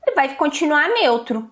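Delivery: ordinary delivery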